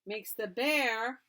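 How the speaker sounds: ordinary delivery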